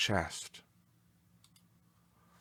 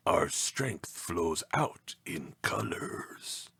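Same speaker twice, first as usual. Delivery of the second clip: strange ancient voice. A narrator's reading. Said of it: "A strange, ancient voice said".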